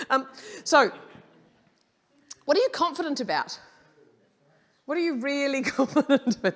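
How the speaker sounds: high, loud and quick, laughing through it; energetic question. Real, not acted. Speaker to distracted audience, bright laughing question. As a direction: pump the crowd up with the question. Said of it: "energetic audience question".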